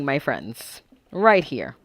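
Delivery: usual speaking voice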